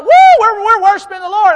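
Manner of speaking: high, loud mocking whoop of showy worshippers; mock shout. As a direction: yell it like a lap-running churchgoer. Mimicking churchgoers running laps shouting.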